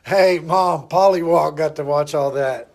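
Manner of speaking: loud, high squawking "Hey, Mom"; parrot voice. Retold or imitated. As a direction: call out like a talking bird. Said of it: "Oh, God, you're really good".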